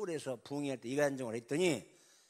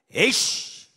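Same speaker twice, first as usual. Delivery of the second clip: loud, higher scoffing curse; angry curse; 9 semitones higher, 18 dB louder, much slower than usual. Acted. President cursing loudly at the refusing general. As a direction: spit out the curse.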